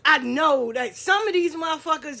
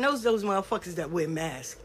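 usual speaking voice

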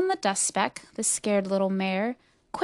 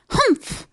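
short, high humph; a high-pitched harrumph; scornful humph; 13 semitones higher than usual. A narrator's reading. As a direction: huff in disdain. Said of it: "humped a voice".

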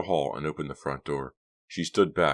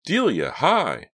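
nervous fake cheer; 12 semitones higher, 6 dB louder, slower than usual. Caught-out greeting.

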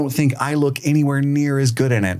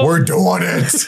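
higher-pitched mock resolve; the reckless part of the brain, quoted; gung-ho inner voice; 6 semitones higher, slower than usual. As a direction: blurt it out as a bold inner voice. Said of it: "other part of your brain was like".

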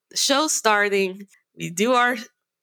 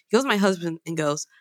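ordinary delivery

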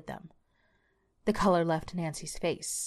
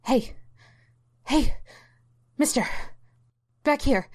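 higher, louder urgent calls; calling out; 8 semitones higher, 4 dB louder than usual. A narrator's reading. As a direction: get the man's attention across the bus. Calling to waking stranger.

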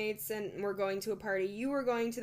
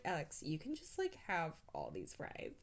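much softer, lower murmured offer; soft generous offer; 5 semitones lower, 9 dB quieter than usual. Retold or imitated. Drunk Bailey offering Alex all her fries, much softer. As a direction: offer the fries softly and kindly.

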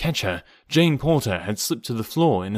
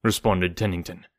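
plain reading voice

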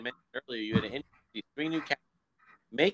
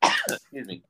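coughing, choking on water; choking on a drink; slower than usual. Real, not acted. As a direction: sputter through a swallowed sip. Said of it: "Choking on my water".

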